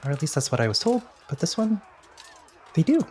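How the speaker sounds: high character voice, halting; Robinson. Acted. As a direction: pitch well up, feeling out each phrase. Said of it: "Robinson's in your ear saying".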